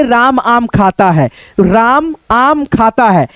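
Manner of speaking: slow, clear dictation of राम आम खाता है. Shown as a demonstration. Teacher dictating राम आम खाता है, slow and clear. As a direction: dictate it slowly so students can write. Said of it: "जैसे मान लो हम कुछ भी बोलेंगे".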